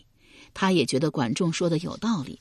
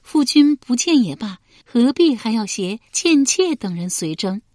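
raised, louder coaxing female voice; a pampered concubine pleading her lord; young woman's voice; 6 semitones higher, 6 dB louder than usual. A narrator's reading. Concubine questioning her lord, raised and louder. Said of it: "叶敬道".

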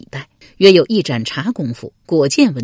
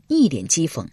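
plain reading voice

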